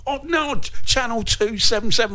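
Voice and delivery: ordinary delivery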